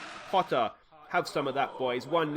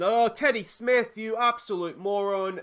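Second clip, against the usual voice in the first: higher, slow, exasperated insult; 8 semitones higher, 6 dB louder, slower than usual. Real, not acted. Manager berating his goalkeeper, slow exasperated outburst. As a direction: drag out the disbelief at the keeper. Keeper blunder in game.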